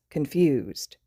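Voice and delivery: usual speaking voice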